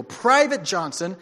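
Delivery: captain roaring his last order at the private; furious captain. Acted. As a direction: threaten him with full force. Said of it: "the captain growled back".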